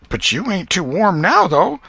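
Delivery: put-on high, sweetly probing old woman; sly old aunt. A narrator's reading. Aunt questioning Tom at supper, high and sly. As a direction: lay a trap with a sweet high voice. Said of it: "and said".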